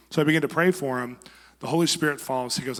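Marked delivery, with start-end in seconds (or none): none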